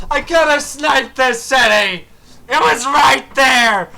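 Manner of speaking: high, loud grieving complaint; bitter loud regret; loud lament. Real, not acted. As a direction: mourn the missed city. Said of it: "going through a Greek tragedy".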